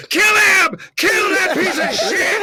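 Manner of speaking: high, loud mob screaming; bay for blood like a frenzied crowd; hammed-up screaming mob; fever-pitch crowd yell. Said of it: "it got to a fever pitch".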